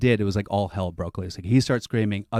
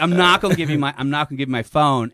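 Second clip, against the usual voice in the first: protesting; 5 semitones higher, 6 dB louder than usual. Retold or imitated. Retold refusal to surrounding cops.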